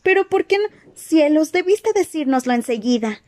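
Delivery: put-on high, flustered young girl; startled and eager, pitch up; excited girl voice. Hermione excited.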